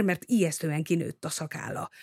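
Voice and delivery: plain reading voice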